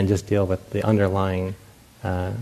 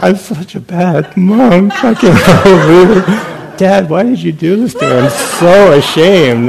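much higher, louder, slow laughing wail; mock wailing shame; 9 semitones higher, 12 dB louder, slower than usual. Monk wailing to his absent dad over candy, hammed-up despair. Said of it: "I'm like".

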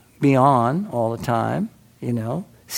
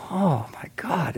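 slow, softer put-upon "Oh my God"; exasperated sigh; 4 semitones higher, 5 dB quieter, slower than usual. Retold or imitated. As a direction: let the annoyance leak out under your breath. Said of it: "I'm going".

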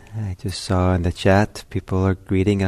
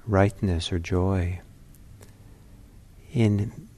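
measured meditation guidance with long pauses; calm, unhurried meditation teacher; guided meditation. Real, not acted. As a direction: ease listeners into stillness, leaving space between phrases.

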